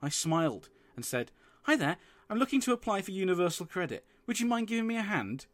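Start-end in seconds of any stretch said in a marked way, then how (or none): 1.6-5.5 higher, polite put-on voice. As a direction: sound as polite as possible. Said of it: "Doing my best to appear polite".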